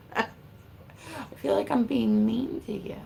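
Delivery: a breathy, slowed-down half-whisper, almost guilty. Real, not acted. Streamer whispering to a viewer she fears she's being mean to. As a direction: hush it like a confession you're half laughing at.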